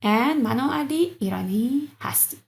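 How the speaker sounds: ordinary delivery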